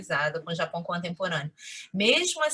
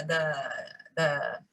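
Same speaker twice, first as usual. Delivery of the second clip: usual speaking voice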